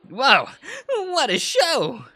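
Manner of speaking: squeaky high greeting; bright squeaky woman's voice. Acted.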